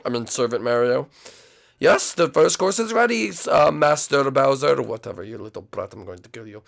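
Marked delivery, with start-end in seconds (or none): 1.8-4.8 high, slowing servant voice; mock servility. Chef addressing Master Bowser, in high mock servility. 4.8-6.6 very soft, lowered grumble; muttered aside. Acted. Chef threatening the brat aside, in a quiet mutter.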